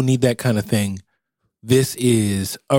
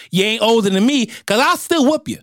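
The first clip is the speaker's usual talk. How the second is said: high scolding grandma voice; 10 semitones higher than usual. Pretend. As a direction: pitch way up and scold the boy. Grandma threatening.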